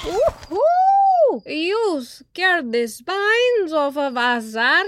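very high whoop, then drawn-out words; whoop, then stretch out the reaction; excited character. A narrator's reading. Startled friend reacts to the macaw.

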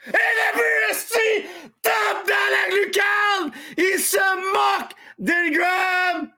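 screaming goal call, way up high; goal shout. Real, not acted. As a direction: erupt like a broadcaster on a goal. Goal call on live stream.

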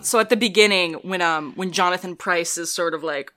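normal talking voice

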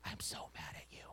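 angry words in a breathy whisper; a hushed mock rebuke; whispered anger. Shown as a demonstration. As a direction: scold in a whisper with no force behind it. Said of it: "You can't be mad when you're whispering".

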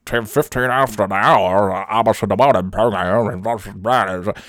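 mushy, slurred mumble with marbles in his mouth; mumbling radio host. Retold or imitated. Mocking the host he couldn't understand, mumbled slurred voice. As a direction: slur it like a rambling old DJ. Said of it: "like, marbles in his mouth".

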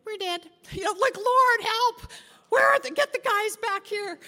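high, loud panicked praying; panicked plea. Retold or imitated. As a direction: gasp it out, sure you're about to die.

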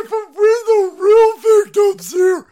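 very loud, high, drawn-out mock wailing; mocking victim wail. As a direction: shriek it slowly as a mocking imitation of complainers. Mock quote of the other side.

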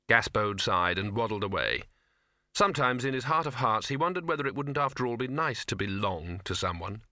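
plain reading voice

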